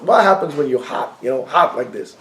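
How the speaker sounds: normal talking voice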